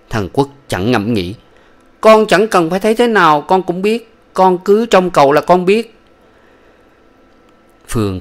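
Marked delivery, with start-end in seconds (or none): 2.0-6.0 put-on high childlike voice, sad and earnest; little boy voice. Small son telling his sick mother about father, high childlike voice.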